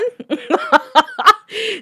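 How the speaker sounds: high, real burst of laughter; hard laughing. Real, not acted. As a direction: crack up laughing. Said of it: "I was laughing so hard".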